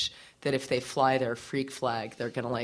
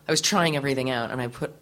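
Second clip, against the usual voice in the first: ordinary delivery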